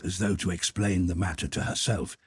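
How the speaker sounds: ordinary delivery, nothing marked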